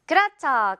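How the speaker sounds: ordinary talk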